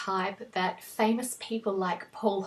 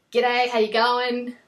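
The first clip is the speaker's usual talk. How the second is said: strong, broad, nasal Australian accent, a little louder; 3 semitones higher, 7 dB louder than usual. Shown as a demonstration. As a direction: ham up a thick broad Australian accent for a greeting. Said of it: "a really strong, broad, nasal".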